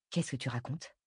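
low, soft, stunned question; dazed. A narrator's reading. Jenna questions her friend's announcement, dazed and hushed. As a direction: ask it dazed, voice dropped. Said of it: "demanda-t-elle hébétée".

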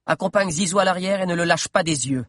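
voix aiguë qui ordonne; appelle-le d'un ton autoritaire; ordre de Mordelia. Acted. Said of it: "ordonna Mordelia". (Mordelia qui donne un ordre sec)

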